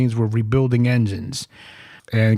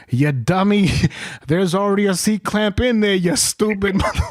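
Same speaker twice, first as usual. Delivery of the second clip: mock scolding; 7 semitones higher than usual. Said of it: "Sergeant Avery looks at the piston and he goes".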